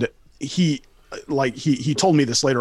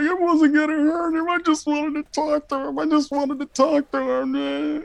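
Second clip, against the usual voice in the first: much higher, whiny mock sobbing like a kid; imitated blubbering; 13 semitones higher than usual. Tweaker on the ground pleading his innocence, mock sobbing. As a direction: play the tweaker bawling like a kid denied a toy. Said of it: "sobbing like a kid".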